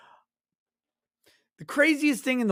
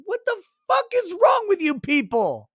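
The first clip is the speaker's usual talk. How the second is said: incredulous outburst; 10 semitones higher than usual. Retold or imitated. Outraged at Midwest food.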